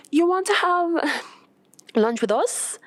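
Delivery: lightly voiced hesitant friend; hesitant invitation. Renee voiced in story.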